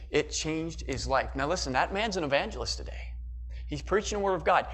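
ordinary delivery

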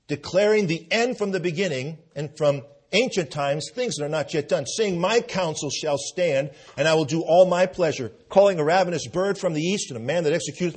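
loud, ringing scripture proclamation; full-force preaching. Acted. Preacher booms Isaiah at the congregation, loud. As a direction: thunder God's words at the congregation.

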